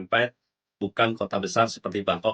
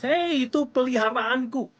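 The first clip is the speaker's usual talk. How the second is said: retold yell; 14 semitones higher than usual. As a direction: cry out in mock outrage, pitched way up. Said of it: "Dia teriak". (seruan tinggi, pura-pura berteriak)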